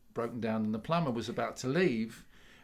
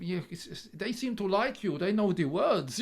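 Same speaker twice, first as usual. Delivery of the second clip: put-on Polish accent; 7 semitones higher than usual. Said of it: "I keep doing the Polish accent".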